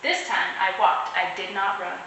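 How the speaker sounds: plain reading voice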